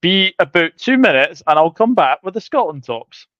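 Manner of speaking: ordinary talk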